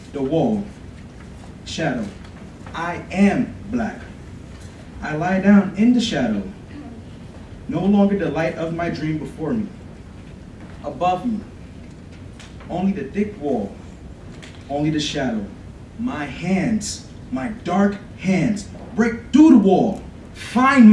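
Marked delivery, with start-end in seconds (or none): none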